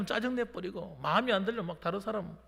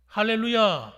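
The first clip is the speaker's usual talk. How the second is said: loud rallying pulpit shout; big, forceful acclamation; hallelujah call; 10 dB louder, slower than usual. Real, not acted. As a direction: call out for the congregation's response. Calls congregation to respond.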